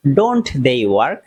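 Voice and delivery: each word drawn out slowly for learners. Shown as a demonstration. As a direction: spell it out slowly like a teacher. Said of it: "আমি খুব আস্তে আস্তে বলছি". (খুব ধীরে)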